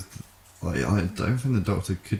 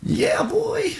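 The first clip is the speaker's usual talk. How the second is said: whispered, high stoner cartoon voice; Shaggy voice. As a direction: breathe it like a dopey cartoon slacker. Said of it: "you sound like a very drunk Shaggy there".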